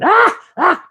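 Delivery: high laughing "ah, ah"; mock laugh.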